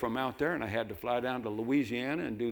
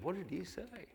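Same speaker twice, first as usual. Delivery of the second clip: frail wife; 8 semitones higher, 10 dB quieter than usual. Retold or imitated. Dying wife asks husband.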